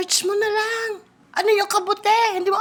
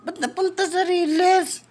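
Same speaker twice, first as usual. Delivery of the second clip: usual speaking voice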